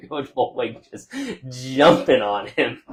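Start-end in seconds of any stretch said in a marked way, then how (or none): 1.5-2.9 laughing. Real, not acted. Broadcaster describing the coach jumping on his player, laughing. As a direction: laugh through the words.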